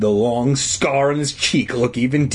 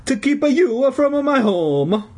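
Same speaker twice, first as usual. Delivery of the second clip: put-on high, slow Italian accent; aggrieved old Italian janitor; 13 semitones higher, slower than usual. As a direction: draw it out in hammed-up Italian. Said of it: "in his hoarse Italian voice".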